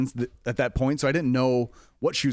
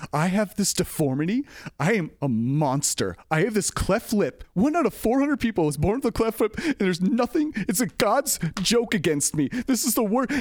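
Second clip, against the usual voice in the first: imitated distressed woman, higher pitched; distraught woman; 8 semitones higher than usual. Offended audience member pleads to staff, mock distraught. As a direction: lament in a raised pitch, retelling her upset. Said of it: "lady who's just distraught".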